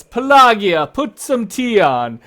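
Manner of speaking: loud, high holler across the house; hearty yell. Acted. Husband hollering to his wife for tea, yelling. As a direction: yell out like a big jovial host. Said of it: "And he yells".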